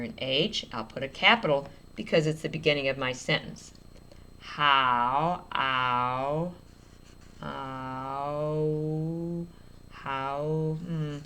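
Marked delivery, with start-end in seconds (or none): 4.4-10.8 slowly stretching the sound "How, ow". Shown as a demonstration. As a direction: draw out "ow" to hear each sound. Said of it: "sounds like a U there".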